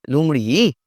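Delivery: normal talking voice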